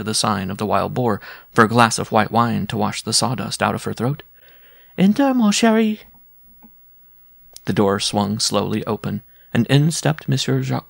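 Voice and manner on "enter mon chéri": high, loud endearing call. A narrator's reading. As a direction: sing out an invitation to a lover. Calling her lover in again.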